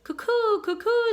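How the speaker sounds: high, loud birdlike coo; cob's call. A narrator's reading. Cob greeting the boy with a high coo. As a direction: sing out a friendly swan call. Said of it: "said the cob".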